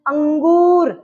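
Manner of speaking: "अंगूर" with a long nasal hum on अं; stress in the nose. Shown as a demonstration. Teacher proves the sound comes from the nose, stressed nasal अं in "अंगूर". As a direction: lean on the अं of "अंगूर" through the nose. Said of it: "नाक में जोर पड़ा".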